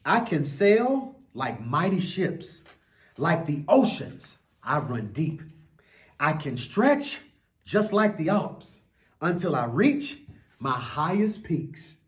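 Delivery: put-on slow, lilting read-aloud for children; an expressive children's book reading; story reading. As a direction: read it like a bedtime picture book. Reads picture book aloud.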